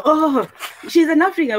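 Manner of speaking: high, lightly voiced onlooker remark; a hint of a curious stranger; mock outsider voice. Others pointing her out as African.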